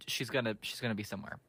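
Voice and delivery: ordinary delivery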